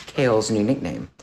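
ordinary delivery

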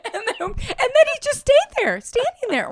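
very high voice through laughter; squeaking words out mid-laugh; real laughing speech. Real, not acted. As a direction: struggle to talk while cracking up. Helpless laughter while telling.

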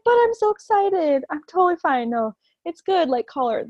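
excited. Retold or imitated. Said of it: "I'm like".

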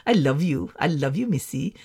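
put-on soft, low romantic Indian accent; imitated Indian accent. Dream man declaring love to Missy, soft Indian accent. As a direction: murmur it as an Indian suitor, quietly. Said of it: "talks like this".

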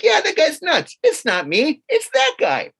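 jumps up high on the last words; exasperated, pointing the finger; mock indignant. Agreeing the waiter is nuts.

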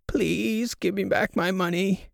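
high, slow pleading granny; freaking-out old grandma. Acted. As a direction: plead like a panicky little old lady. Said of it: "It's gonna be a little old grandma".

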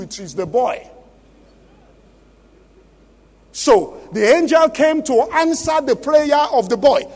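loud, declaiming Bible reading; preacher thundering the King James text; forceful scripture reading. Acted. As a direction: proclaim the verses to the whole hall.

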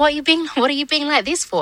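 half-pleading, half-demanding, unable to believe it; incredulous high plea. Retold or imitated. [name] retelling how she asked Bobby at the door, incredulous high plea. Confronting him at his door.

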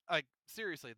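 ordinary talk

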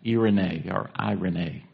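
"Irene" said as ee-ray-nay, then eye-ray-nay; "Irene" in three syllables, first ee, then eye; Greek pronunciations. Shown as a demonstration. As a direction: give "Irene" two Greek readings, each ending in nay. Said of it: "it's pronounced differently".

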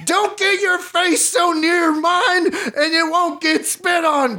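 very high, slow mock bellow; drawn-out, high-pitched mock tirade; hammed-up bellow. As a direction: stretch out every word in comic outrage. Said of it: "bellowed Mage".

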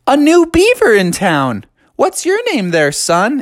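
high, hearty beaver voice; hearty greeting. A narrator's reading. Young beavers greet a newcomer, hearty greeting. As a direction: hail the new kid in town.

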